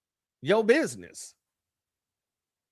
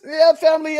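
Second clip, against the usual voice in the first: loud greeting; 8 semitones higher, 6 dB louder than usual. Real, not acted. Greeting stream viewers loudly.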